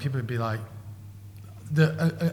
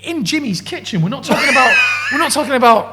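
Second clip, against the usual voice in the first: high, loud and laughing; incredulous laughing rant; 8 semitones higher, 8 dB louder than usual. Real, not acted. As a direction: shout it out in disbelief.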